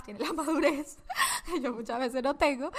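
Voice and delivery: admiring husband's maturity, she laughs at herself; laughing while admitting. Real, not acted. Admitting her husband is more mature.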